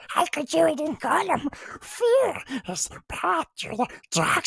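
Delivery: high, slow squeaky little Yoda. Retold or imitated. Teacher hams up Yoda for the class, high and slow. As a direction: drag each clause out in a high creature voice. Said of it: "It's terrible".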